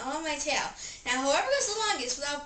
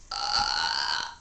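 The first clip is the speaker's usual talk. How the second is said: deep breathy burp. Acted. Burping contest, burps.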